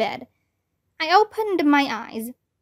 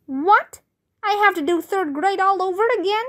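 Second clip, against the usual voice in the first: shocked protest; 6 semitones higher than usual. Acted. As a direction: react as if it's a disaster. Boy hears he repeats grade.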